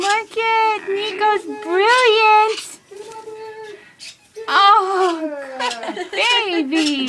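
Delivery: high, loud excited praise. Real, not acted. Praising baby bird.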